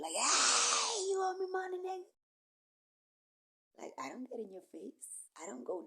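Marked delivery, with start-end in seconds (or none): 0.1-2.1 loud, high yelling at a debtor; shouted demand. Retold or imitated. Calling out a man who owes money, loud shout. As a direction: holler it across the street.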